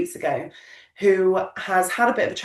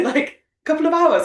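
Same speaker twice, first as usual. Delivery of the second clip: ordinary talk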